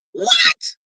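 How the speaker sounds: high, rising yelp of disbelief; shocked. Real, not acted. Host reacting to the license news, shocked. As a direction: squeal it in disbelief.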